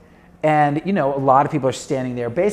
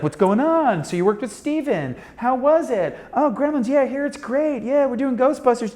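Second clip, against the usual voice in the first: chatty Aykroyd; 9 semitones higher than usual. Retold or imitated. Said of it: "is like".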